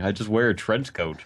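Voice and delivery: ordinary delivery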